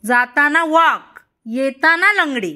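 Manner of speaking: high, loud, slow teaching call; clear instruction. Shown as a demonstration. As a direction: spell out the command loudly for the child. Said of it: "तू नीट ऐकतच नाहीयेस". (मुलाला मोठ्याने, हळू, स्पष्ट सूचना)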